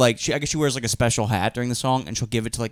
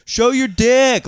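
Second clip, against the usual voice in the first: high, loud, slow heckling shout; hammed-up drunken crowd shout; mock crowd yell; 11 semitones higher, 5 dB louder, much slower than usual. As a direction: bellow it like a rowdy heckler. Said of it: "everybody kept saying".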